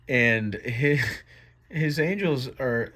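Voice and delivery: ordinary delivery, nothing marked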